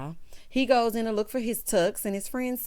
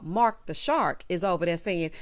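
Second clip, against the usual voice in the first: ordinary talk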